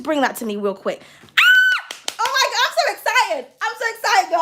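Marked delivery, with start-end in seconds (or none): 1.2-2.0 high excited shriek. Real, not acted. Tarot reader thrilled by the card, high shriek. 2.2-4.4 laughing, higher excited gush. Real, not acted. Reader gushes to viewer Kirsten over the card, laughing excitedly.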